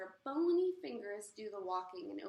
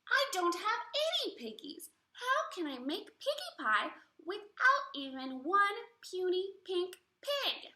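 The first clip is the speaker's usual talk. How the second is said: high, loud whiny witch; cranky witch wanting pig; 9 semitones higher, 7 dB louder than usual. Acted. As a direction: complain like a greedy witch. Witch Gritch complaining aloud.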